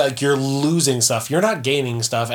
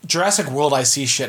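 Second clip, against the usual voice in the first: normal talking voice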